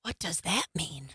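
put-on hushed, wondering child voice; little son. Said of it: "and he's like".

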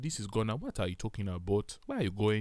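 low-pitched mock Nigerian accent. Shown as a demonstration. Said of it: "If I want to be from Nigeria".

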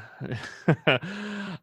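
high breathy burst of laughter; breathy giggle. Real, not acted. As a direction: break up laughing mid-read. Co-host mimes brushing teeth.

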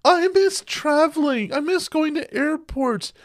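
high, slow mocking whine. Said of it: "those people that say".

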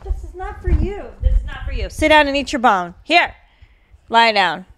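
high, loud dog-owner voice, scolding the pet; talking to the dog. Real, not acted.

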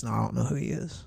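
soft, quick brush-off; mild denial. Retold or imitated. Peter to bystanders at Jesus' trial, mild denial. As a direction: deny it quietly, played down.

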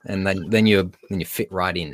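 usual speaking voice

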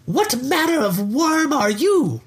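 put-on a grand old dragon. Dragon character voiced in reading.

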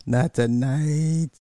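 high, slow and stretched out; drawn out. Real, not acted. Drawn-out repeat of the phrase.

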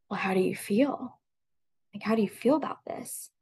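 a near whisper, held steady to stay strong; hushed question. Retold or imitated. Daughter gently asking her dying mother how she feels, hushed. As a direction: ask it softly so as not to break.

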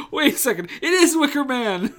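high, loud sudden realization; a hammed-up dawning shock; retold jolt of recognition, pitched high and loud; mock realization. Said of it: "I was like".

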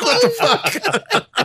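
very high, drawn-out squeal through laughter; laughing disbelief. Retold or imitated. Storyteller voicing the stunned patient, helplessly laughing. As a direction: squeak it out slowly while cracking up.

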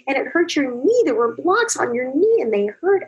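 put-on high, slow, soothing voice to a hurt toddler; tender comforting. Mother soothing her hurt two-year-old, high and tender. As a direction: coo gently over the little one's knee. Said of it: "you're saying".

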